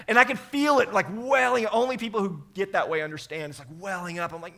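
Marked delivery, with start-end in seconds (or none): none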